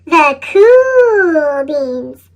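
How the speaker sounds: very slow, low and impressed; a smooth, admiring drawl; slow awed echo. A narrator's reading. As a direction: stretch the words out in awe.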